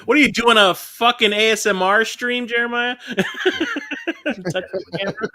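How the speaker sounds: high, loud mocking disbelief; incredulous teasing. Real, not acted. Teasing friend touching camera.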